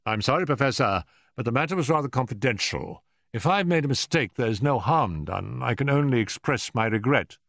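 polite but unyielding detective; courteous Holmes. A narrator's reading. Holmes withholds his informant from the Professor, politely apologetic.

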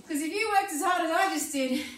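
normal talking voice

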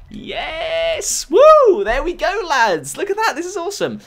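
high, whooping celebration; squealed hurrah; excited cheering. Real, not acted. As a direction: cheer with the lads in a high, giddy voice. Celebrating getting into the game.

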